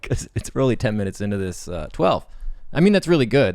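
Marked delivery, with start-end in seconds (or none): 2.7-3.5 enthusiastic. Real, not acted. Enthusiastic praise.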